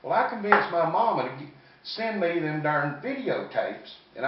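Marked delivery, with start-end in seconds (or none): none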